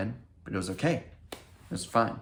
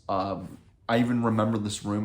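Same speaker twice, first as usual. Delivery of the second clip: ordinary delivery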